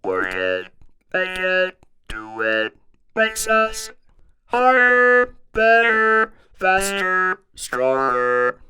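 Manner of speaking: robotic sung synth words, each on its own held note; talk box demo. Shown as a demonstration. Host giving viewers a little demo, robotic talk box singing. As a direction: sing robot-like through a talk box, jumping in pitch. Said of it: "Let's give it a little demo".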